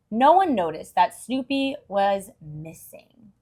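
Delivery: ordinary delivery, nothing marked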